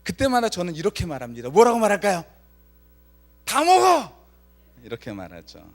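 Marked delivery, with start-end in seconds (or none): 3.5-4.2 much higher, louder furious yell; little brother shouting at big brother; angry shout. Retold or imitated. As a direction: yell it, fed up with your brother. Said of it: "차분하게 말하지 않았겠죠".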